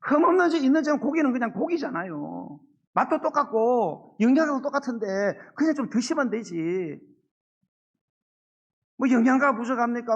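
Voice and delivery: loud, high, fervent sermon delivery; full-force preaching. Acted. (목소리를 높여 힘차게 설교하듯)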